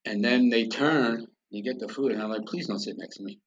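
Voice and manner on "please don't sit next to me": very soft, low retold thought; quiet plea kept to himself; muttered inward plea. Man silently begging a family not to sit near him, muttered low.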